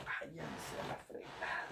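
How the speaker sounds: slow whisper under the breath; whispered curse. Retold or imitated. Recruit cursing the soldier under his breath, whispered. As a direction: mutter it so the soldier can't hear. Y dije.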